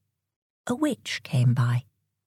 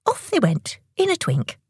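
ordinary delivery, nothing marked

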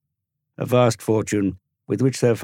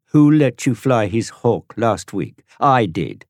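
put-on louder, slow boy's retort; rounding on him; 7 semitones higher, 8 dB louder, slower than usual. Tom rounding on Dorian, loud slow retort. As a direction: throw each favour back at him. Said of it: "Tom rounded on him".

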